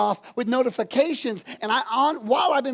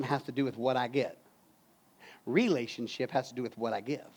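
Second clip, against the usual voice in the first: hushed preaching; 9 semitones lower, 7 dB quieter than usual. Real, not acted. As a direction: bring them in close and quiet. Closing preaching point, hushed.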